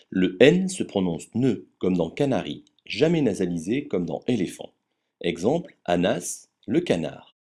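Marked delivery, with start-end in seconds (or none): none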